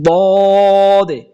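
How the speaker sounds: "দদে" stretched long and thick; heavy dad. Shown as a demonstration. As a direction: hold the "দদে" heavy and slow. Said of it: "একটু মোটা গাঢ় করে হবে".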